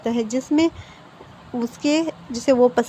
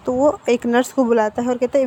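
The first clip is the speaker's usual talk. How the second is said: plain reading voice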